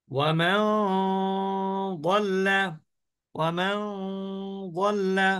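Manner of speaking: ওয়ামান ending in a measured nasal hum, then যাল্লা; timed ghunnah demo. Shown as a demonstration. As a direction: hold the last "n" of ওয়ামান in the nose before যাল্লা. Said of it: "গুণনা হচ্ছে এক আলিফ মাদের সমপরিমাণ".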